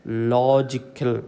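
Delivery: Logical with a drawn-out, weighted LO; stress on LO. Shown as a demonstration. Teacher correcting the stress, LO emphasised in logical. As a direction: lean hard on LO in logical. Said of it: "ഇവിടെ ഓളജിക്കല്ല stress".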